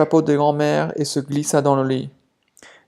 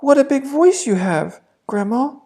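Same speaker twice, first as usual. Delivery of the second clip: put-on higher wondering child's voice; a child puzzled by grandma; surprised girl; 10 semitones higher than usual. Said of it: "in surprise". (the girl at the bed, surprised)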